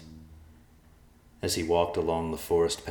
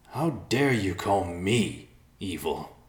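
angry through clenched teeth; 3 semitones higher, 3 dB louder, slower than usual. A narrator's reading. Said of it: "clenched his teeth".

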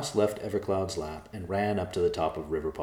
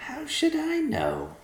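much higher, slow indignant squeak; 3 dB louder, slower than usual. Acted. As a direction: huff it out, offended. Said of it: "the mouse replied indignantly".

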